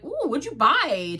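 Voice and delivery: high, loud, slow mock-eager ooh; eager Hannibal. Hannibal asking Will about the gift, hammed-up eager. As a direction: gush like you can't wait to see it.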